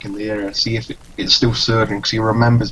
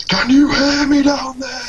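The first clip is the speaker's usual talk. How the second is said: shouting down into a pit, pitch raised high; 3 dB louder than usual. Acted. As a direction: call down into the pit. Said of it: "he's gonna shout down".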